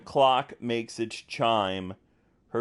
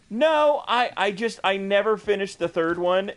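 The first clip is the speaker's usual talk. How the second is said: giddy; 9 semitones higher, 3 dB louder than usual. Real, not acted. Still giddy after laughing.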